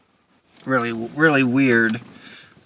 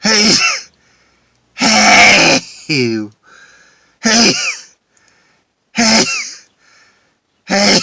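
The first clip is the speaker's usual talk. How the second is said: loud sneezes in a row; sneezing fit from pepper; 11 semitones higher, 11 dB louder, much slower than usual. Real, not acted. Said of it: "Oh, I have to sneeze".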